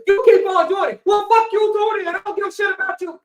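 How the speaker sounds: high, loud furious outburst; hammed-up rage, loud and high; mock angry yell. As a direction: blow up at them. Imitated man snaps again.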